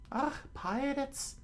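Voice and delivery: high character voice, angry; angry Nami. Acted. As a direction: spit out the word pirates with disgust. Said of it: "Nami said with anger".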